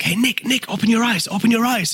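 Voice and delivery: high-pitched, insistent call to Nick; urgent calling out. Retold or imitated. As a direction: imitate a friend calling for attention. Acts out guy calling to him.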